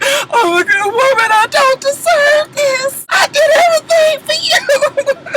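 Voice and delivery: high, slow, far-off wailing sob; frantic crying. Retold or imitated. Baby Knees alone in the room, frantic wailing. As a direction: wail it like a breakdown. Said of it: "she's frantic".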